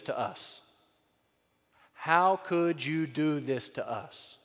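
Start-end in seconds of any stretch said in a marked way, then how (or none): 1.9-4.4 slow, weighty repeat of a mother's reproach; slow and deliberate. Acted. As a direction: draw out each word of the reproach. Line repeated slowly for weight.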